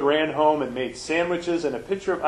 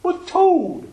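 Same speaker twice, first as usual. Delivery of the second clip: much higher and louder frog voice; happy Frog voice; 6 dB louder than usual. Acted. Frog reassuring Toad on the island, high happy voice. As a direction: Frog reassuring his friend. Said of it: "said Frog".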